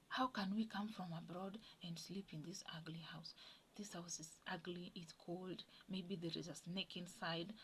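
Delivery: hushed, secretive whisper of a sneaky plan; hushed scheming whisper, imitating him; retold conspiratorial whisper. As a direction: whisper it so the parents won't hear. Husband secretly proposes sneaking back.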